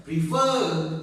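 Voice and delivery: "refer" with the last syllable stronger; stress on second syllable. Shown as a demonstration. Teacher demonstrating how we pronounce the word, "refer" stressed on the end. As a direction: make the "fer" of "refer" the strong part. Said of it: "the sound is stronger".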